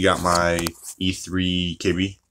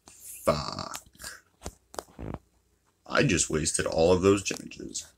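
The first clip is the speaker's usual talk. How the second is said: low regretful groan; 4 semitones lower, 7 dB quieter, much slower than usual. Retold or imitated. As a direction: drop the pitch low, then a slow, quiet regret. Realizes the waste, retold.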